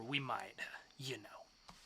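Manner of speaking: sly whispered hint; suggestive whisper. Acted. Bartender whispering a suggestive invite to Corinne. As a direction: drop to a whisper as he hints. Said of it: "dramatic reading".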